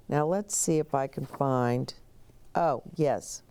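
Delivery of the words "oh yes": usual speaking voice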